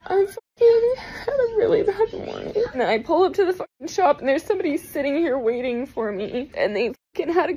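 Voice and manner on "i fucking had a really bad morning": laughing through tears; tearful, half laughing; crying laugh. Real, not acted. Tearful tattoo artist.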